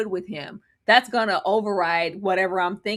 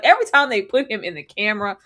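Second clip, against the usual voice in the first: ordinary delivery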